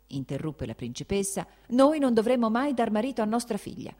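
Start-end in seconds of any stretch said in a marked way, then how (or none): 1.7-3.9 higher, louder, indignant; heated retort. Acted. The princess arguing with her husband, heated. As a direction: throw it back at him.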